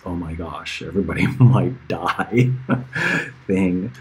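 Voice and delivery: ordinary talk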